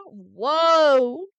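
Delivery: loud burst of surprise; surprised whoa. Acted. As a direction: blurt it out loudly. Mock excited exclamation.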